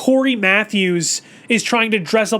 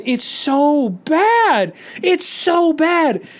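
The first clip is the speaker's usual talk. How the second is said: slow, higher drawn-out groan of dismay; 4 semitones higher, much slower than usual. Real, not acted. Reviewer groaning at a bad scene, slow high dismay. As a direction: stretch it out in disbelief.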